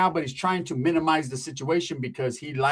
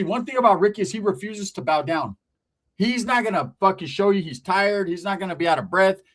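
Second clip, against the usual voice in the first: ordinary talk, nothing marked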